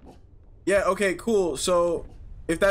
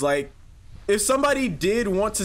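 normal talking voice

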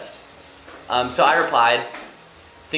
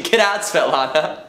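laughing; 6 semitones higher, 8 dB louder, slower than usual. Pretend.

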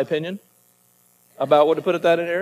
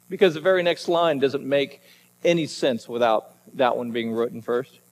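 usual speaking voice

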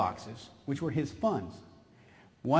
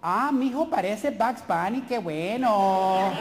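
mother; 3 semitones higher than usual. Retold or imitated. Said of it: "my mom would say".

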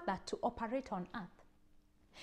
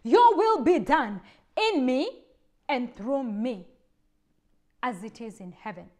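prayer recited; 8 dB louder than usual. Shown as a demonstration. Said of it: "That is why we say".

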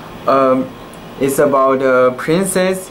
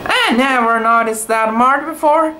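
higher, lightly voiced surprise; mild wonder; 8 semitones higher than usual. A narrator's reading. Tai Tai seeing the star mark, mild higher surprise. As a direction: sound newly struck. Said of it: "Tai Tai said".

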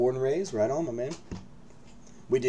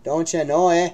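teasing Canadian "don't you know, eh", louder and higher; mock Canadian accent, louder and higher; 7 semitones higher, 12 dB louder than usual. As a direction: rib him in a hammed-up Canadian accent. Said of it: "You chased out the Canadian".

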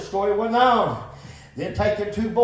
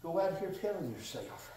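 quiet reflection; 6 semitones lower, 8 dB quieter than usual. Real, not acted. Softer reflective confession.

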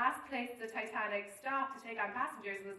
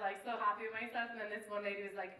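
ordinary talk

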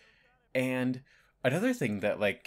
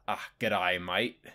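a light, lazy over-the-top Australian "g'day mate"; a hint of a hammed-up Australian drawl; 3 semitones lower, 3 dB louder, slower than usual. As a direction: toss off a half-hearted Aussie ocker greeting. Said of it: "stereotypical, sort of accent".

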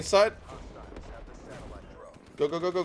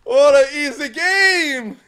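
gloating; 8 semitones higher, 8 dB louder, much slower than usual. Real, not acted. Gloating at the win.

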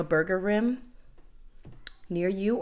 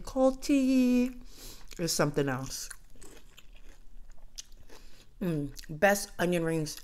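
chewing while talking; mouth full; 4 dB quieter than usual. Real, not acted. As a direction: food in mouth. Seen 1: Bit ring at -3s, mouth working, re-bites at 2.8s.

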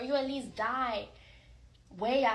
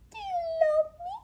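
squeaky high rising pleas; high pet voice; 3 dB quieter than usual. Acted. Begging his dog to say he loves him, squeaky high coo. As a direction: coo up high to coax an answer.

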